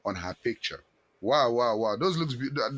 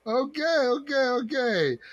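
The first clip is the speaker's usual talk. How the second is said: ordinary talk, nothing marked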